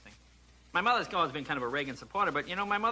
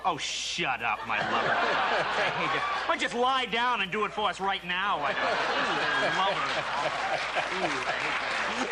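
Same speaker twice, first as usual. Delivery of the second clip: hammed-up dramatic lovers; slower than usual. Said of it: "you get very dramatic".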